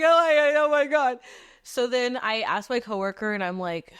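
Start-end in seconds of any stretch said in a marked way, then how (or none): 0.0-1.2 loud, high-pitched mock panic; hammed-up freak-out. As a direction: shriek it like a panicking friend. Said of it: "someone to freak out with me".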